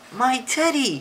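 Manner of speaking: high, loud excited cry; exclaimed. Acted. Eddie finds his teddy, exclaiming. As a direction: exclaim it, pitched well up. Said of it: "He's exclaiming something".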